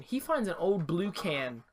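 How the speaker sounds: ordinary delivery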